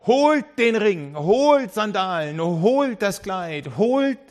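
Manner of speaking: a father beaming, ordering a feast; joyful orders. Acted. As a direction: call out each order with delight. Father ordering servants excitedly.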